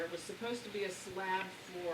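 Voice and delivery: ordinary talk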